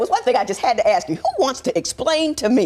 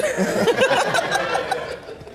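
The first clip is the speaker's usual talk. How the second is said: high, breath-filled laughing; amused burst of laughter; real laugh; 13 semitones higher than usual. Real, not acted. Judge laughs at her own remark.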